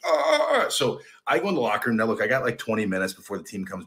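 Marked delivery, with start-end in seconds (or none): 0.0-0.7 high, loud acted "ah"; startled cry. Long snapper lathered in Vaseline, acted startled cry. Acts out his startled reaction.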